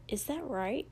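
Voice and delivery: soft aside question; muttered. A narrator's reading. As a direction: mutter it to the person beside you. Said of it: "Izuku muttered".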